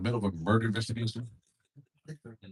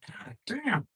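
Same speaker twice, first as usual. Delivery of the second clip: ordinary talk, nothing marked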